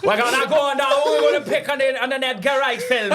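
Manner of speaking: high, loud hammed-up Geordie accent. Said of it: "in a Geordie accent".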